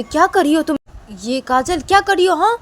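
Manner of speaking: loud, high scolding; stern shout. Acted. Principal breaks up the fight, loud high scolding. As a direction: stop the fight with a sharp yell. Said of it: "तो बोलते".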